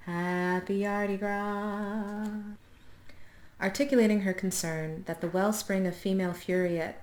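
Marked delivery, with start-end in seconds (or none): 0.1-2.3 slow drawn-out greeting. Pretend. Playful drawn-out wordplay greeting.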